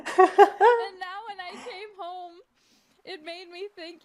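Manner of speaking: high, slow laugh-talk; laughing through words. Real, not acted. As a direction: laugh while saying it. Laugh-crying telling the story.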